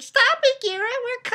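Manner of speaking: pleading cry. Retold or imitated. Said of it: "then Jericho's like".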